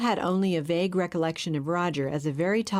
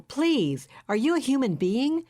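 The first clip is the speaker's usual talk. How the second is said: indignant plea; 7 semitones higher than usual. A narrator's reading. Nicole pleading with Roger.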